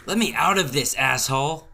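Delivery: high, loud angry shout; furious yell. Acted. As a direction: scream it at your captor. Tied up, yelling at Dan.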